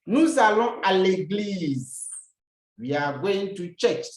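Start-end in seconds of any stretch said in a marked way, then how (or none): 0.1-2.1 slow, loud, deliberate model sentence. Shown as a demonstration. Teacher giving students the example, slow and loud. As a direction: spell it out slowly for the class. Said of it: "Let's have examples".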